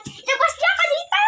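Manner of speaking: very high, strained cry; high-pitched prisoner's plea; desperate demand. Acted. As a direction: cry out to be freed from a cell.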